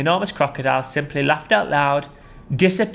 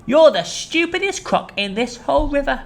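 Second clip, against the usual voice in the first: much higher scornful voice; mocking small croc; 13 semitones higher than usual. Small crocodile taunting the enormous crocodile. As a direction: sneer at him in a high voice.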